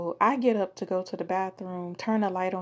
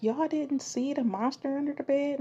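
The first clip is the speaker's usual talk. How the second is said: put-on child self; 6 semitones higher than usual. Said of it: "and I'm like".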